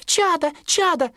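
very high anxious chirp; high, fussing bird-mother voice; worried mother bird. Acted. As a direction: fret high like a mother sparrow. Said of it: "беспокоилась мать".

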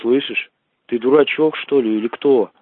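normal talking voice

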